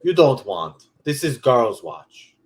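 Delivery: slow, loud Russian saleswoman; mock her Russian accent, slowly. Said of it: "She's like".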